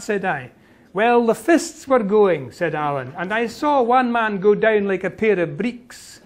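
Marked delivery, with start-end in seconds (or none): 0.9-2.5 slow Highland Scots relish; Highland Scots Alan. Acted. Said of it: "you can hear the Gaelic intonations". 3.2-6.2 Highland Scots lilt with a chuckle; amused Highland Scots Alan. Acted. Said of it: "you can hear the Gaelic intonations".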